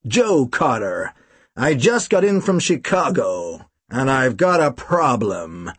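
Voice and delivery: slow, lightly American voice; understated Chicago drawl; American accent. Acted. Visitor tells the detective his problem in a light American accent. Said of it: "He spoke with an American accent".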